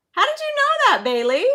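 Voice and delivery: very high-pitched amazement; happy disbelief. Real, not acted. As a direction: marvel at the winner in a high voice. Amazed by child's right answer.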